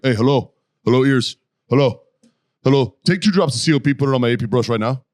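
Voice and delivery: a hint of a loud Colombian accent; barking orders with a light Colombian accent; impression of sergeant Catalan. Recruit imitating Colombian sergeant, loud with light accent. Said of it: "I could do a really good impression of him".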